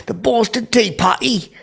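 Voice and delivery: very high, drawn-out British parody; exaggerated mock British accent. Showing the bit done for Ben Cumberbatch, hammed-up British accent.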